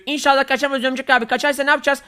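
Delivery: put-on high, eager little kid voice; high kid sidekick begging to be waited for. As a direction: squeak it like a small scared boy.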